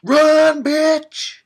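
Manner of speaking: high, loud shout; yelling at the screen. Real, not acted. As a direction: scream it at the character on screen.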